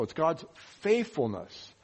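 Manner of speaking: ordinary talk, nothing marked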